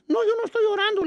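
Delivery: put-on very high little-boy voice; imitating a tearful small boy; little kid denying tears. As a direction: squeak out a childish denial. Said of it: "Era como los chiquillos".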